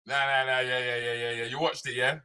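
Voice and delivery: ordinary talk, nothing marked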